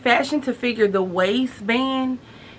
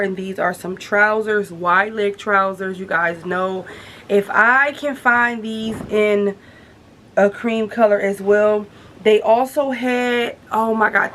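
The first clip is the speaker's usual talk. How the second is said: usual speaking voice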